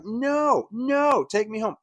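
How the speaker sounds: drawn-out high wail of refusal; mock screaming. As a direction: imitate a child screaming in refusal. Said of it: "I was screaming".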